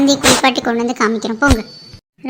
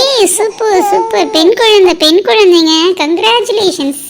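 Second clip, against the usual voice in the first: higher, delighted cry of news; excited announcement; 4 semitones higher, 3 dB louder, slower than usual. Acted. Doctor announcing a baby girl, higher and excited. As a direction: beam with joy at the birth.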